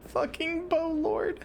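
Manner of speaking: high, slow, drawn-out squeak; amused disbelief. Real, not acted. Streamer still tickled by a character's name, high-pitched amusement. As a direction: savour the silly name in a high, stretched voice.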